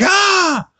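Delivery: very high, loud put-on yelp; Stewie yelp. Stewie erupting with eggs, high loud yelp. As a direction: shriek it out high.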